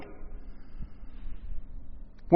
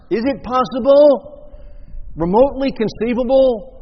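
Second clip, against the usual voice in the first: imploring prayer; 8 semitones higher, 5 dB louder than usual. Acted. Acting out Jesus's prayer in Gethsemane.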